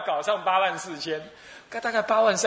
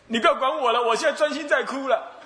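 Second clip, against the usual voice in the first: high, sulky sobbing refusal with a laugh in it; mock crying; 7 semitones higher than usual. Old man brushes off the Buddha, crying. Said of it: "他在专心哭".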